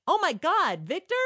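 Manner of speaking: high, loud excited gasp of recognition; excited exclaim. Retold or imitated. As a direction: blurt it out thrilled at seeing him. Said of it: "I said".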